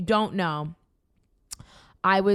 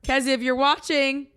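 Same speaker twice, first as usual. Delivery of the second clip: loud, higher mock toast; playful toast; 6 semitones higher, 5 dB louder than usual. Joking toast to her watching ex-manager, playfully called out. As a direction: call out a cheeky cheers.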